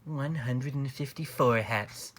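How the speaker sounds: usual speaking voice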